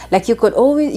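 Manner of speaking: ordinary talk, nothing marked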